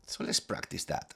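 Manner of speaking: ordinary talk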